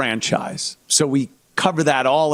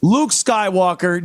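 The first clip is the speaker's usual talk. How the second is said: normal talking voice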